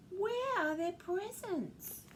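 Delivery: high, drawn-out question; slow, high-voiced child's dismay. A narrator's reading. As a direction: wail it slowly, up high. Children in story miss presents.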